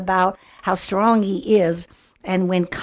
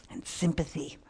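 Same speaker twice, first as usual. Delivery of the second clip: quiet reflective repeat; soft echo; 3 semitones lower, 11 dB quieter than usual. Shown as a demonstration. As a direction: repeat it much softer, almost to yourself.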